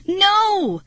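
high, loud, long cry of horror; terrified wail. A narrator's reading. Girl seeing Marty sprawled on the floor, terrified. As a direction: wail it out in terror. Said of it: "I let out a terrified wail".

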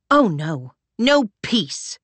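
fierce refusal; defiant. A narrator's reading. Claire defiant at Rami.

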